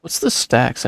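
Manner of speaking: usual speaking voice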